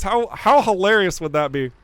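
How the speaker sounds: normal talking voice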